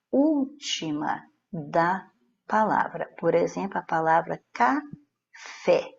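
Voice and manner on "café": "café" split slowly into two beats, ca... fé; sílaba por sílaba. Shown as a demonstration. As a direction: say "café" syllable by syllable, pausing before the stressed fé. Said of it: "a última sílaba é tônica". ("café" em sílabas separadas, ca... FÉ)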